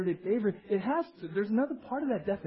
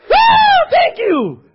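very high, loud whoop; exuberant gratitude; 16 dB louder, much slower than usual. Shown as a demonstration. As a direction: whoop and shout your thanks. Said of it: "I give you permission".

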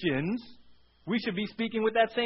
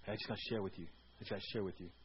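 much lower, hushed gossipy murmur; mock gossip voice; 10 semitones lower, 13 dB quieter, faster than usual. Preacher to church, mocking a gossip, low and hushed.

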